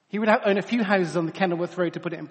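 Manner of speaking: normal talking voice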